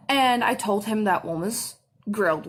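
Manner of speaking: usual speaking voice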